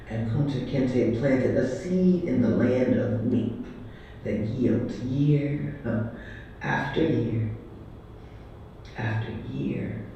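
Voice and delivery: measured, declaiming poem recital; spoken-word poem. Acted. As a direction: proclaim each line like a stage poet.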